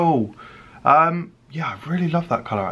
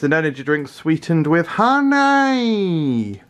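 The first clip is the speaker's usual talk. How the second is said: higher, slower mock product pitch; odd announcer voice; 5 semitones higher, slower than usual. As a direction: present the drink like a cheesy ad. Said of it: "I don't know why I said that like that".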